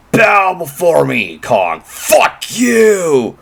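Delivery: a hint of monster overlord; slow, mocking command; dark lord. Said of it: "the better way to say is that Godzilla".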